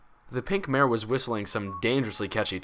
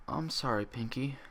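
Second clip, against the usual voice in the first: soft, small ashamed apology; guilty apology; 4 semitones higher, 7 dB quieter than usual. Acted. Twilight apologizing to Pinkie, soft and ashamed. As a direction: say it quietly, full of guilt. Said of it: "Twilight apologized".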